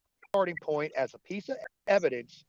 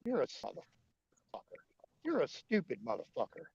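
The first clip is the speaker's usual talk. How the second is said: muttered aside; 4 semitones lower, 8 dB quieter than usual. Retold or imitated. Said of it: "just shaking their head going".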